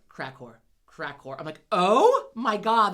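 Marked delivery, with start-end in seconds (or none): 0.1-1.4 slow, low imitation of a little boy repeating; child parroting words. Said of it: "And he start". 1.7-2.9 loud, drawn-out mock horror; hammed-up appalled parent, much louder and higher; retold panic. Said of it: "I'm in a full-on panic".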